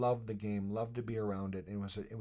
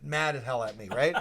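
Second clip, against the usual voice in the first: usual speaking voice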